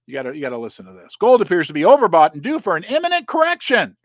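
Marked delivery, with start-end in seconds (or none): 1.2-4.0 louder, dramatic headline read; mock ominous reading. Host reading an alarmist article to viewers, mock dramatic.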